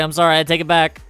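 ordinary delivery

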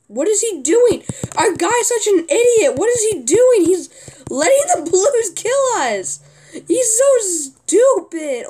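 loud exasperated rant at a teammate; real gamer rage at a clueless ally; frustrated yelling. Real, not acted. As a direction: yell it, fed up and furious. Raging at teammate griefing.

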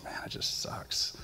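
breathy whisper, almost no voice; whispered thought. Retold or imitated. Man feeling attacked all week, whispering to himself. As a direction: whisper it to yourself, worn down.

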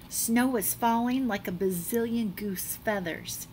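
plain reading voice

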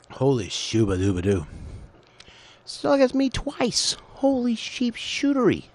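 way up high, loud, cartoonish; goofy high-pitched exclamation; silly squeaky voice.

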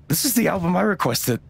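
high-pitched, delighted recognition; light up on recognising the gift; excited discovery. Acted. Friend unwrapping a surprise album, excited.

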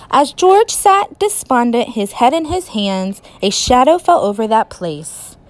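ordinary delivery

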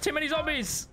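high-pitched gamer panic; panicked cry. Real, not acted. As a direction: cry out as you get swamped. Swamped by zombies in game.

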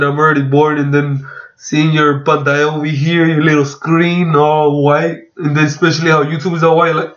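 normal talking voice

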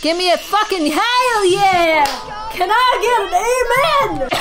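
loud, rising, drawn-out whoop; hyped-up cheer. Real, not acted. Gamer celebrating staying inside the lines, hyped-up cheer. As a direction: shout it slowly like rallying a crowd. Celebrating a successful spell trace.

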